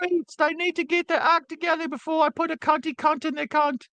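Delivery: very high, put-on British lady voice; Margaret Thatcher impression. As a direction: squeak it like a bad Monty Python Thatcher. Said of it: "that's my Margaret Thatcher".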